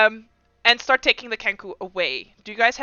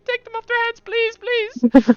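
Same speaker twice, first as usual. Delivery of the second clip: much higher, parroting, pleading; squawky kenku mimicry; 13 semitones higher, 4 dB louder, slower than usual. Kenku parroting the guard, high and pleading. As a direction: echo the words like a desperate bird.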